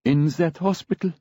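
high, tearful voice; sobbing. A narrator's reading. Grieving friend answering on the phone, tearful. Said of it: "Frieda was sobbing".

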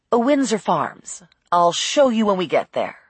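usual speaking voice

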